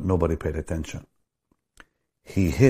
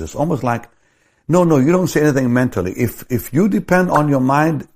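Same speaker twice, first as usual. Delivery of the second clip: loud, high, driving sermon delivery; preaching at full force; 6 semitones higher, 7 dB louder than usual. Acted. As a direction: hammer it home to the congregation.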